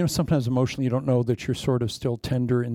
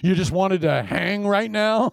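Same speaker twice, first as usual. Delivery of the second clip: slow, higher, incredulous mock question; mock disbelief; 6 semitones higher, 4 dB louder, slower than usual. Preacher mocks a caller who only phones when in need, slow incredulous. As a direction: needle them with disbelief. Mocking needy estranged caller.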